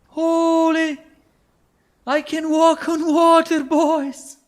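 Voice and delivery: high shouting god proclamation; megalomaniac rant. Acted. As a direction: yell it like you've just become a god. Walks on water, declares godhood.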